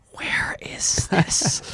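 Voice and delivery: breathy, slow, horrified whisper; whispered disbelief. Retold or imitated. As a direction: gasp it out in disbelief. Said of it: "And they're like".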